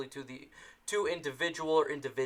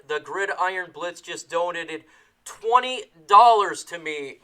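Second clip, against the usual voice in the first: excited; 2 semitones higher, 8 dB louder than usual. Real, not acted. Reacting to donation live.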